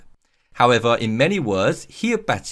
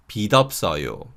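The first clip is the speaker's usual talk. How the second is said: quicker linked demo; much faster than usual. Shown as a demonstration. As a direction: say 빛 없어요 briskly as "bi-deop-sseo-yo". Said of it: "unreleased sound carries over".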